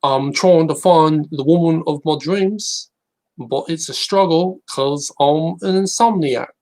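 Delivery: slow, high put-on British accent for jokes. Telling jokes as a talent-show contestant, British accent. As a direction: deliver one-liners in a hammy British accent. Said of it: "how I'd talk if I was doing Britain's Got Talent".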